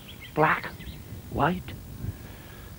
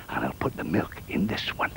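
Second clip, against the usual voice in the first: breathy whisper aside; 10 semitones lower, 9 dB quieter than usual. Acted. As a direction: whisper it to yourself.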